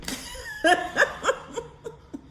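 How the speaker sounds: real high laugh at herself. Real, not acted. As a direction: laugh it off at the top of the voice. Laughs at her own struggle.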